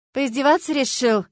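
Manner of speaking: irate accusing question; angry. A narrator's reading. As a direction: confront him crossly. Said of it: "зло спросила я".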